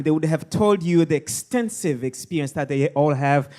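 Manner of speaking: ordinary delivery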